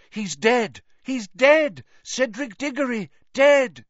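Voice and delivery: high, loud screeching; screeching crowd. A narrator's reading. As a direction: shriek it into the night. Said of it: "screeched it into the night".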